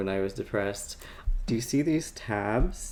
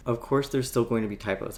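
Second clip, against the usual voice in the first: ordinary talk, nothing marked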